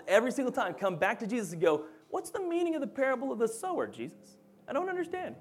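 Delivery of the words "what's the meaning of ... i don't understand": high-pitched, baffled follower pleading for an explanation; hammed-up bewilderment, high-voiced; confused disciples. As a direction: play a clueless disciple way up high, dropping on the name. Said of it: "They're confused by his parables".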